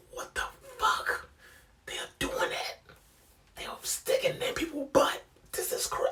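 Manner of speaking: retold horrified whisper; hushed disbelief. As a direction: whisper it like you can't believe what you heard. Shocked aside in classroom retelling.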